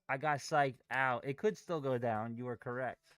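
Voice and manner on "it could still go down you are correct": normal talking voice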